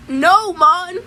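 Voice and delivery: a sharp, high yelled no; shouted refusal. Retold or imitated. Shouting no at the woman twirling his mullet. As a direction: recoil and yell it.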